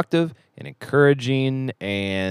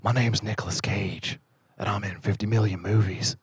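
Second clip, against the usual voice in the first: a lightly voiced country-ish Nicolas Cage; Nicolas Cage impression; 2 semitones lower, 7 dB quieter than usual. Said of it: "Andy Samberg's impression of Nicolas".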